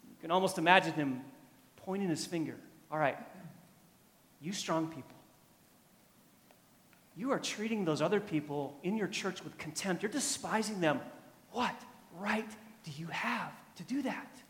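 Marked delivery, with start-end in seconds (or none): 2.9-14.4 restrained confrontation, spaced out with pauses; measured rebuke, pressing the question softly; confronting. Acted. Paul pointing his finger at the strong believers, restrained confrontation. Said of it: "He confronts them".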